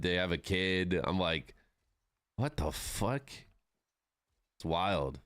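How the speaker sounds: ordinary delivery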